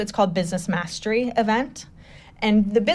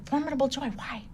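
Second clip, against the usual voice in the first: quiet, higher-pitched hushed answer; hushed reply; 5 semitones higher, 9 dB quieter than usual. Retold or imitated. Her answering the man beside her mid-talk, hushed. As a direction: imitate whispering back during a talk.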